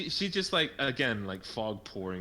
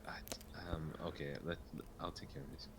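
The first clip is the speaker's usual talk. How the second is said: soft, half-whispered hesitant aside; hushed muttering; 4 semitones lower, 12 dB quieter than usual. Acted. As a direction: mutter it under your breath. Character mutters a covert plan.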